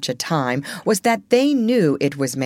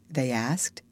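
ordinary delivery, nothing marked

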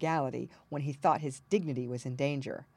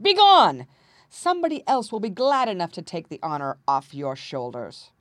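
roaring dismissal; 2 semitones higher, 10 dB louder than usual. A narrator's reading. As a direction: drive him out, then settle slightly. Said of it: "roared the king".

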